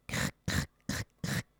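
very soft whispered "cric, cric" scratching noise; tiny creaks of claws on the roof; creaking tiles. Acted. Cat creeping over the tiles, soft scratching whisper.

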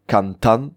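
"cantan" with a strong final "tan"; stress on last syllable. Shown as a demonstration. Teacher giving the present participle, stress on "tan" in "cantan". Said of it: "L'accent est sur la dernière syllabe".

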